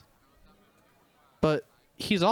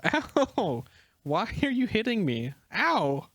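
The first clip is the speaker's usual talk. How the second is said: higher, repeated yelps; yelping under blows; 6 semitones higher, slower than usual. Acted. Legoshi yelps as Haru keeps punching him. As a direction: protest each punch.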